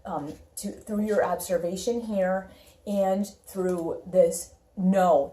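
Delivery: ordinary delivery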